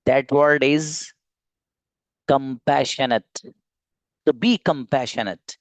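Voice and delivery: slow, drawn-out reveal of compassionate; word reveal. Real, not acted. Teacher unveiling his favourite English word to the class. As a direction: savour the word compassionate slowly. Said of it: "एक लफ्ज है जो मुझे खुद को अच्छा लगता है".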